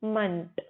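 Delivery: 'मंट' with a schwa, munt; correct ending. Shown as a demonstration. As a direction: isolate 'मंट' as a low, weak final syllable. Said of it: "इम्प्रूवमेंट नहीं, मंट".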